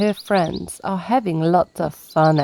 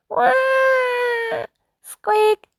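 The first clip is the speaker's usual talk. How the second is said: put-on toddler wail. Toddlers fussing.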